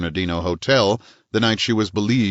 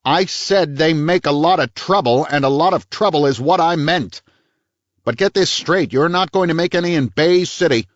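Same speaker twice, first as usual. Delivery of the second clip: louder, higher, sharper chief; raised voice; 9 semitones higher, 4 dB louder than usual. A narrator's reading. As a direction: raise your voice and lay it down. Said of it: "He raised his voice".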